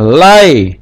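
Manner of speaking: lai as one closed syllable; silent sheva. Shown as a demonstration. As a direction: say lai in one glide, no vowel after the y. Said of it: "tidak ada vokalnya".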